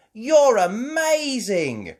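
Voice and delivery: high, loud, drawn-out praise; children's cheer. Acted. Children praising Mummy, cheering. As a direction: squeal it out in admiration. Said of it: "Peppa and George cheered".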